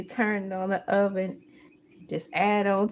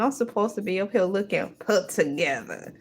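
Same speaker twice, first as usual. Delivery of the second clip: usual speaking voice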